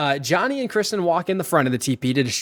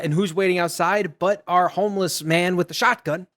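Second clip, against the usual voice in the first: ordinary delivery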